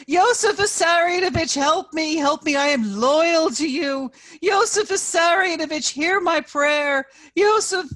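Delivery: high, loud frantic pleading; old woman wailing a prayer to Stalin; desperate wailing prayer. A narrator's reading. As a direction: wail the prayer as she's dragged off. Arrested woman praying to Stalin.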